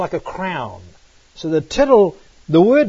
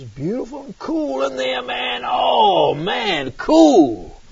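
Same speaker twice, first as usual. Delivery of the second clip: relieved delight; 8 semitones higher, slower than usual. Retold or imitated. Relief entering cool house.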